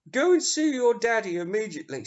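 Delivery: hammed-up snooty mum ordering her son; posh mum.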